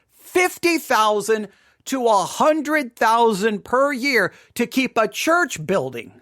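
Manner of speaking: slow, weighted repeat of the figure; slow emphatic repeat. Shown as a demonstration. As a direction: lean on each number slowly so it sinks in.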